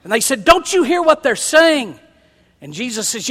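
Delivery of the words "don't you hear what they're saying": indignant. Retold or imitated. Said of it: "They were indignant".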